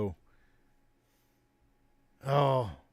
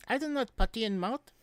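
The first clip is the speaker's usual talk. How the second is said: put-on very high, foreign-accented comic voice; Latka impression; 11 semitones higher than usual. Host answering chat about Andy Kaufman, as Latka in a high foreign accent. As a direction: squeak it out like a sitcom immigrant character.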